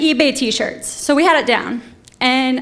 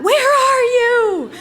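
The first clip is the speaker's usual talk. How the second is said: high, slow, louder hammed-up yell; mock yell; 11 semitones higher, 4 dB louder, much slower than usual. Ex-boyfriend yelling in the street for Comcast, hammed-up slow yell. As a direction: bellow it down the street in mock outrage. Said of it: "he was in the street yelling that".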